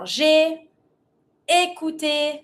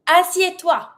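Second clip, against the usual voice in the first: higher-pitched order to sit; energetic command; 5 semitones higher, 4 dB louder than usual. Shown as a demonstration. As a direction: command the animal with lift. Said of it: "We always try to be super energetic".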